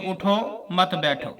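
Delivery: slow "उठो", a clear stop, then "मत बैठो"; pause after उठो. Shown as a demonstration. Teacher giving an Urdu example of a required stop, pausing after उठो. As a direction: break right after उठो, keep मत बैठो together. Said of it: "उठने पर ठहरना लाजमन है".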